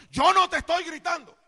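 loud high yell, denying he is yelling; husband yelling at his wife that he isn't yelling; defensive yell. Acted. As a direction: snap back at the accusing wife. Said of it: "él se siente acusado".

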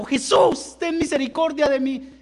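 loud, high cry for mercy; shouting plea. Acted. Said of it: "sigue gritando".

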